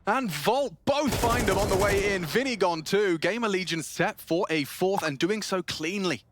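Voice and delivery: high, loud hype commentary; excited play-by-play. Real, not acted. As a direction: call the kill like it's huge. Round-winning play called live.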